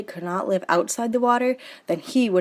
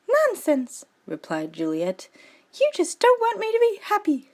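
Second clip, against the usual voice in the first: put-on much higher, petulant girl; Juliet's voice; 13 semitones higher than usual. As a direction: snap back like a sulky teen. Juliet angry at cousin.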